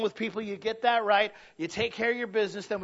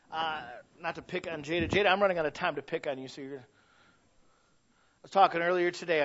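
ordinary talk

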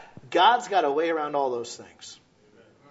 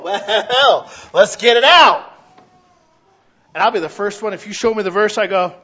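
normal talking voice

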